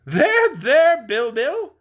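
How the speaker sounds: high, soothing coo to a pet; calm the grumpy goat kindly. Acted. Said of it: "said King Rinkitink soothingly".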